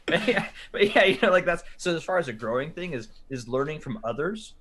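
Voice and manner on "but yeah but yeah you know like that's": laughing. Real, not acted. Laughing after friend's joke.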